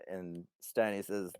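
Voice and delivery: usual speaking voice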